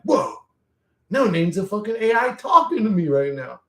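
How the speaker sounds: retold amazement. Retells realizing he's talking to AI.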